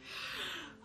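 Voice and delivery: long audible breath out after the inhale; deep exhale. Shown as a demonstration. Instructor to class, demonstrating a deep exhale. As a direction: let the breath go with a sigh. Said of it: "And take a deep breath in".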